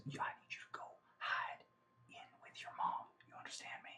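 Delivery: put-on hushed, urgent whisper to a child. Arthur whispering orders to the girl, urgent hush. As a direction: whisper it fast so nobody outside hears.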